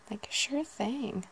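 sure stretched long and slow, high; drawn-out sure thing. Shown as a demonstration. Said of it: "quite a few ways of saying that".

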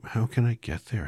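put-on little girl voice. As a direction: ask like an eager young girl. Dorothy asks the witch.

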